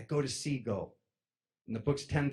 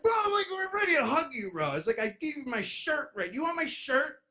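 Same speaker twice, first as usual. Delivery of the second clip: high desperate plea; 13 semitones higher than usual. Retold or imitated.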